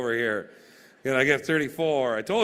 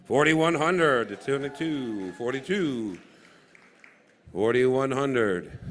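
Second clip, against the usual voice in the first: auctioneer call; slower than usual. Real, not acted. Slow drawn-out bid calls.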